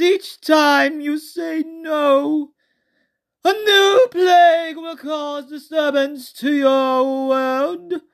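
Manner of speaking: high, squeaky, slow and silly; goofy high-pitched voice. Shown as a demonstration. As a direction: make it sound ridiculous. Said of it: "but it was with this voice".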